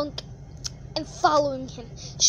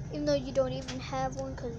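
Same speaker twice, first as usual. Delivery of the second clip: usual speaking voice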